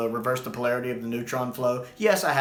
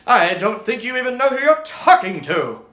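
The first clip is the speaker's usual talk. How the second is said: much higher, louder, very slow and grand; imperious sixth Doctor; 11 semitones higher, 6 dB louder, slower than usual. Retold or imitated. Reviewer imagines the sixth Doctor defying his accusers, grand and outraged. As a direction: declaim it like an outraged Time Lord on trial. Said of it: "Even the sixth Doctor would have been like".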